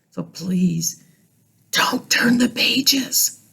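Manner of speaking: slow whispered plea; pleading whisper. Acted. Grover begging the children not to turn. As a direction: beg them in a whisper. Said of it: "Grover pleads in a whisper".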